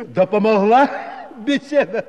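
high, loud laughing tease. Acted. Mocking question, laughing.

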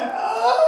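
high, loud swooning squeal; swooning shriek. Retold or imitated. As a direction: squeal like a smitten woman. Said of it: "Her cheek just went".

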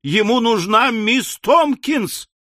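high, drawn-out scandalized cry; collective shriek. A narrator's reading. Women learn he wants Miss Tomkins, shrieking. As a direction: squeal it slowly in alarm. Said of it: "Поднялся общий визг".

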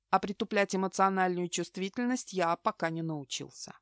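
usual speaking voice

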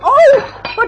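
oh jumps way up high and loud; a sudden startled cry. Acted. Sudden reaction amid clatter. (een hoge, luide verschrikte oh)

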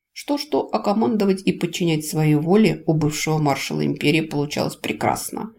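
ordinary delivery

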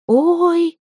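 high-pitched startled little "oy"; sudden high squeal of alarm; startled cry. A narrator's reading. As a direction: yelp it as if caught off guard. Startled girl jumps up.

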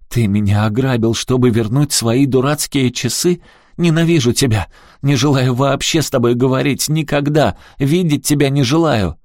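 high, loud, rising rage; furious outburst. A narrator's reading. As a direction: hurl the accusations at full voice. Daughter's furious outburst at father.

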